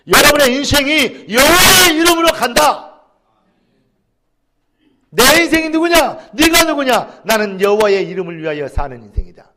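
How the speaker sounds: shouted, high and loud proclamation; fiery preaching. Real, not acted. Preacher shouting to the congregation about their lives. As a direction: cry it out to the congregation.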